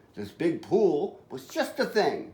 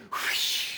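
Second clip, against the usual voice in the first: airy, voiceless whoosh; long sliding whoosh of air; whoosh sound effect; 5 dB quieter than usual. Acted. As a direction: imitate rushing water with breath. Narrator imitates the rush of water.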